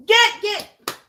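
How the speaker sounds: high, loud shout to scare dogs off; shooing. Real, not acted. Host yelling at stray dogs in her yard, loud high shoo. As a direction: chase them out of the yard.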